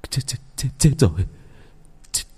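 breathless whispered dread bursting into a loud cry; panicked horror. Acted. Husband finding his bride dead, panicked whisper then cry. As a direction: gasp it out, then let the terror break loose. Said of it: "Anh hoảng loạn".

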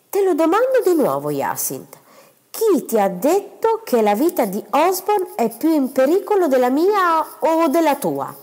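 a stern husband questioning his wife; stern demand. Acted. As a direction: insist on the answer, no escape.

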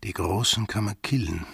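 usual speaking voice

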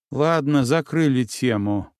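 usual speaking voice

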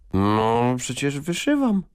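high, put-upon protest; mildly exasperated; sighing. A narrator's reading. Lady-in-waiting scolded by the queen, sighing retort. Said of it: "westchnęła Stanisława".

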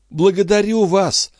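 high, delighted female character voice; overjoyed. Acted. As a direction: thank him with a rush of joy, pitched high. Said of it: "несказанно обрадовалась". (радостно, высоким голосом)

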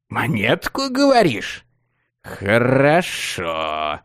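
soft, slow menacing villain hiss; ominous hiss. A narrator's reading. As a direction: hiss it out like a gloating sorcerer. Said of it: "зловеще прошипел он".

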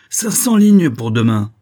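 loud, slow schoolmaster's sentence; irritated voice. A narrator's reading. Professor punishes his former pupil, irritated. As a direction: hand down the punishment loudly and slowly. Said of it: "d'une voix empreinte d'irritation".

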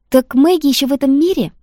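high, loud, gleeful surprise; delighted. Acted. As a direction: burst out overjoyed. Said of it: "почему-то обрадовавшись". (радостно, с восторгом)

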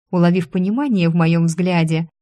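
ordinary delivery

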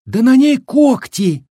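high, loud, horrified cry; aghast. A narrator's reading. Village woman spotting claws on the meat, aghast. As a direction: cry out the discovery, stretched high. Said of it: "ахнула одна из баб".

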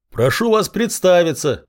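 calling out across the moat; shouted. A narrator's reading. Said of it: "прокричал я".

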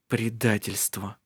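slow hushed whisper. Acted. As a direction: breathe the word out quietly. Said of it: "прошептал Скоктавл".

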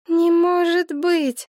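high, slow monotone denial; dazed, droning disbelief held high; on one note. A narrator's reading. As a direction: repeat it like a wound-up toy, stuck on one pitch. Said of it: "повторяла Елена на одной ноте, как заведённая".

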